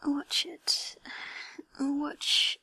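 intimate breathy ASMR whisper. Acted. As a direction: whisper close to the mic, teasing.

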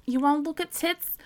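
normal talking voice

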